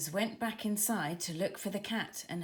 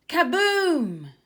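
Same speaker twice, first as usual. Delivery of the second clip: much louder, higher burst; dramatic kaboom; 8 semitones higher, 11 dB louder than usual. A narrator's reading. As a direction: boom it out like an explosion. Magical whoosh in story.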